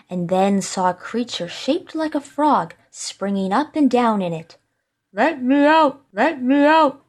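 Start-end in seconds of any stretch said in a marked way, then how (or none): 5.1-7.0 high-pitched muffled plea; smothered spirit cry. A narrator's reading. Creature in the bottle begs the scholar, smothered cry. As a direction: beg to be freed in a strange, raised voice. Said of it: "it cried anew".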